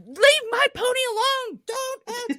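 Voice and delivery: put-on high, childlike scolding cry; shrill little girl warning off a bull; little girl yelling. Little girl yelling at the bull, high childlike voice. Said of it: "to, like".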